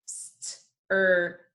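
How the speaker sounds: slow-motion phonics "stir"; said slowly. Shown as a demonstration. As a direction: STIR drawn out, st sliding into a long er. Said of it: "let me say it slowly again".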